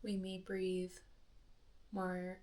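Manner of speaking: soft, hushed meditation voice with long pauses; quiet, spacious delivery, letting each phrase settle; guided meditation. Acted. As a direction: gently guide a listener into breath awareness, softly and unhurriedly.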